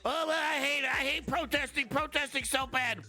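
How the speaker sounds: high, whining mock voice; mock whiny imitation of right-wing critics; mocking whiny right-winger. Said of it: "all the right-wingers in the chat".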